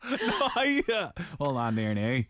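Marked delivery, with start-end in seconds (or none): 1.4-2.2 low Northern Irish lilt; Northern Irish accent. Shown as a demonstration. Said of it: "I'm just gonna do a little witty bitty accent".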